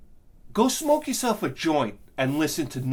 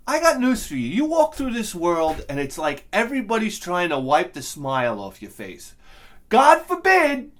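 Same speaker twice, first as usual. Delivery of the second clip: angry rant; 6 dB louder than usual. Real, not acted. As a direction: blow up at the hater. Angry rant about the comment.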